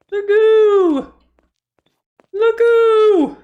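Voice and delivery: very high, loud squeal; squeaky high voice. Acted. Repeats the goo in voices.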